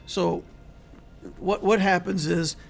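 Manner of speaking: hoarse, strained voice; losing voice. Real, not acted. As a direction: voice giving out mid-stream. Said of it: "I keep losing my voice".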